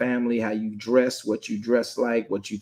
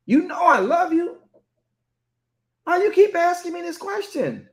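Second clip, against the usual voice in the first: much higher, exasperated and whiny; contentious; 3 dB louder than usual. Retold or imitated. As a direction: protest like you're sick of the question. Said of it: "he became contentious".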